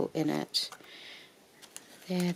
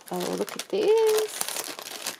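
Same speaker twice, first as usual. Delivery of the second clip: much higher, slow, awed "oh, look"; delighted gasp; 4 semitones higher, much slower than usual. Real, not acted. Showing viewers the beaded lace, gasping in delight. Seeing the Marie Antoinette lace.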